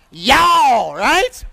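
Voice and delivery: loud Kentucky drawl on \"Y'all\", falling. Shown as a demonstration. Preacher to congregation on plural you, Kentucky accent. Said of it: "how do we say plural you in Kentucky".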